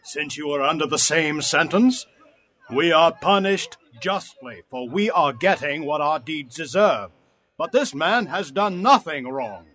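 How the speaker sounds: rebuking. Acted. Criminal defends the innocent man, rebuking his fellow. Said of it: "the other criminal rebuked him".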